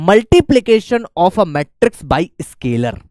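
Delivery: very slow "multiplication of a matrix by scalar"; slow dictation. Shown as a demonstration. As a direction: spell out the topic slowly for notes. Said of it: "next अपने पास आता है". (धीरे-धीरे बोलकर लिखवाना)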